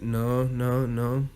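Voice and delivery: ordinary talk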